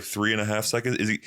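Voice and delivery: ordinary talk, nothing marked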